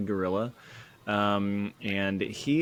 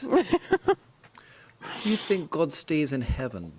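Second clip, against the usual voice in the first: higher-pitched mock accent of a comic character; Kunk accent; 6 semitones higher than usual. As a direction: put on the comedian's accent, pitched up. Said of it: "like, the Kunk accent".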